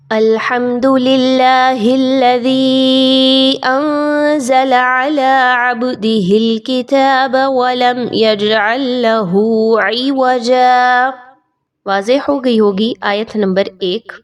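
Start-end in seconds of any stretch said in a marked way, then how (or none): 0.1-11.1 slow melodic tilawat with bouncing qalqala; Quranic recitation. Acted. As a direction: recite slowly and melodiously like a qari. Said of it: "एक बार तिलावत करते हैं". (धीमी सुरीली तिलावत)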